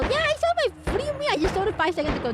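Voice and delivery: high-pitched, rapid protest; indignant answer. Real, not acted.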